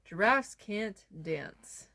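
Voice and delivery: plain reading voice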